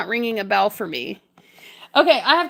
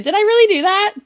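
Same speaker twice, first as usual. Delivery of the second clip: "really" lifted high and louder in delight; excited disbelief; 6 semitones higher, 4 dB louder, faster than usual. Real, not acted. Streamer asking her chat about her subscriber goal, excited and higher. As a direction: ask "Did I really do that?" bursting with joy. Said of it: "I am so excited".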